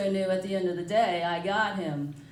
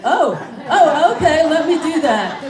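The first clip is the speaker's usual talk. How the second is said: loud, high, laughing uptake; hammed-up bright-idea reaction; sudden eager realization; 5 semitones higher, 10 dB louder than usual. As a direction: seize on the advice with mock eagerness.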